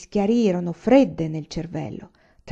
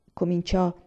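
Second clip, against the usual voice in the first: ordinary delivery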